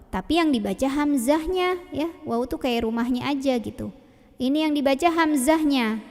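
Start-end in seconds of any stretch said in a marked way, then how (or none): none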